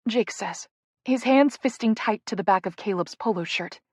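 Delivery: plain reading voice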